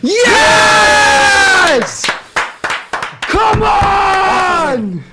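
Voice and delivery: high, loud joyful roar; celebration shout. Real, not acted. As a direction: roar it out as the final whistle goes. Full-time celebration shout.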